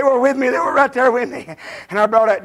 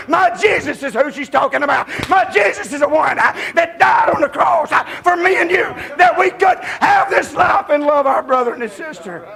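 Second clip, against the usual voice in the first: preaching at full force; 3 semitones higher than usual. Real, not acted. Preacher proclaiming his Jesus to the congregation, full shout. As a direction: shout it out to the church.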